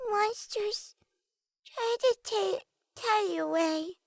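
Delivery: put-on high, slow little-girl voice; a frightened child near tears; child whispering. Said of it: "Clancia whispered".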